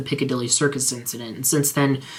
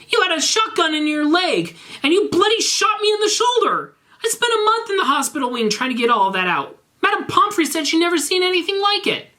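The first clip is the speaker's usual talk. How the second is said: furious rant. Acted. Draco rants at Garfield about being shot, high furious.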